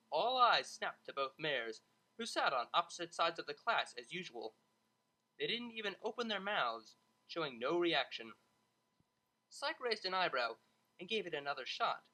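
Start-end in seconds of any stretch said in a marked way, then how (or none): none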